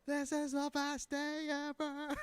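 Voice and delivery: high, soft, drawn-out squeal of joy; mock ecstasy. Criminal overjoyed at a McNugget spree, mock ecstasy. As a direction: slowly squeak it out, overcome with delight.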